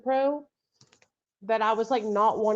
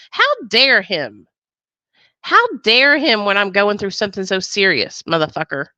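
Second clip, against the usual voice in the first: mock outrage; 8 dB louder than usual. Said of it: "I'm pissed".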